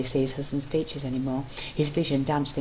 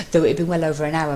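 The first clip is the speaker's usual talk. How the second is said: ordinary delivery, nothing marked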